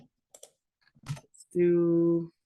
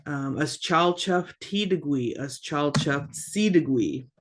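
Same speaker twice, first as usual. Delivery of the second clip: normal talking voice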